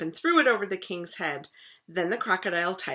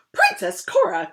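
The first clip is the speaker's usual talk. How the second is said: cried out; 9 dB louder than usual. Acted. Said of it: "cried the king".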